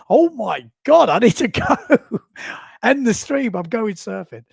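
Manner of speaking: loud, high burst of panic; hammed-up sudden urgency with a laugh; mock urgency. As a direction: blurt it out like you must leave now. Acts out urge to drop everything.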